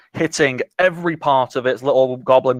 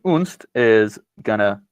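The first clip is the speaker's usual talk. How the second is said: normal talking voice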